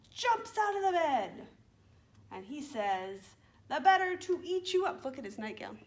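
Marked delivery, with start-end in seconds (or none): 0.1-1.5 higher, punchy storytelling climax; spring the surprise on young listeners; dramatic story surprise. A narrator's reading. Story's surprise moment, louder and higher. 3.7-5.0 loud, high storybook wolf; pouncing wolf. Acted.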